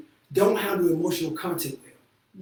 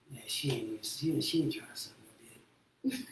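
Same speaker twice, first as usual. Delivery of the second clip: grumbling man; 6 semitones lower, 10 dB quieter than usual. Retold or imitated. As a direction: mutter it like a let-down guy. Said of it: "be like".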